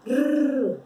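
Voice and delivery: rolled trilled rrr. Shown as a demonstration. Teacher demonstrating the Spanish R, strongly rolled. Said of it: "forçar esse R aí".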